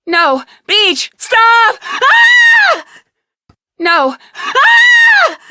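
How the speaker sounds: desperate high pleas breaking into loud screams; panicked screaming. Acted. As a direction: scream for him to stop as you're dragged off. Second take of the struggle scream.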